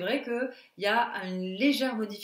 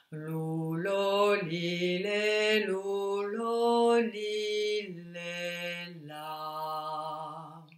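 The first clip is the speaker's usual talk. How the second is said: deepest possible voice; 6 semitones lower, 4 dB quieter, much slower than usual. Shown as a demonstration. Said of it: "La voix la plus grave possible".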